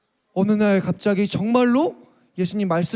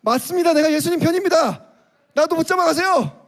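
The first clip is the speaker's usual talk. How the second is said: brave Peter; 7 semitones higher than usual. Pretend. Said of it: "베드로 어떻게 했을까요".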